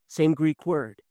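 usual speaking voice

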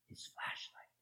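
breathy hushed reveal; whisper. A narrator's reading. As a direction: whisper it like a secret. Dramatic whisper in story.

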